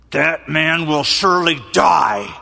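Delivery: slow furious shout; angry shout. Pretend. David enraged by the rich man's theft, shouting. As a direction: shout the sentence slowly in rage.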